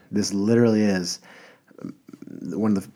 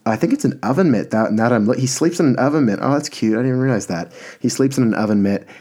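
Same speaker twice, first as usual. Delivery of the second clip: real excitement, higher and louder; delighted realization; 5 semitones higher, 7 dB louder than usual. Real, not acted. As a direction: gush over a cute discovery, pitched up. Delighted real discovery in the picture.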